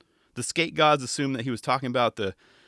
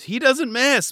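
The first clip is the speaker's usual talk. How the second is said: much higher and louder confident call; higher louder mock commentator; 11 semitones higher, 6 dB louder, slower than usual. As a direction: belt it like a cocky commentator. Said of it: "But it was hilarious".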